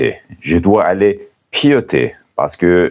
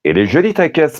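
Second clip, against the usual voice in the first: high, lively admiring line; playful example; 9 semitones higher, faster than usual. Shown as a demonstration. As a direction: compliment a friend's car, playfully. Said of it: "par exemple".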